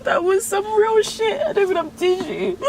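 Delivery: real sobbing, high strained voice; high-pitched, tearful self-reproach; crying. Real, not acted. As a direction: cry through the words slowly, sobs breaking in between. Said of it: "I can't believe I cried again".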